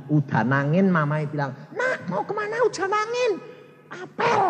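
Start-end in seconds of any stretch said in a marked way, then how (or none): 1.7-4.4 high, light voices; mother questions son, son says date; mock mother and son. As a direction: act out the little exchange.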